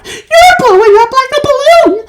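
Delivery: shriek. A narrator's reading. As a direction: shriek in horror. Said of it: "shrieked Missus Beauregard".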